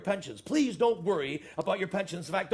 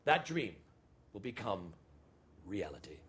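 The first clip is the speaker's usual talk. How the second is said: solemn slow close; 6 semitones lower than usual. Acted. Boss closing his speech to staff, slow and solemn. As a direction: wind down portentously with long pauses.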